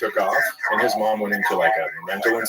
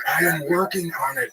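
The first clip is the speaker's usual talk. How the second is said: higher-pitched irritated retort; mock exasperation; 7 semitones higher than usual. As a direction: push back at a hovering mom, pitch up. Acting pitcher annoyed at mom.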